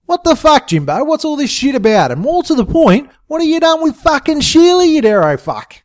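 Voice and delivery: high-pitched, indignant questioning; angry demand. A narrator's reading. Friend confronts Jimbo over missing Sheila, angry demand.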